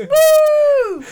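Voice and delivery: loud high cheer; excited whoop. Real, not acted. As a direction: whoop it out with glee. Cheering the politics joke.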